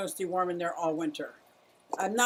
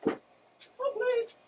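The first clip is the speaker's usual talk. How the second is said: squeaky plea. Shown as a demonstration.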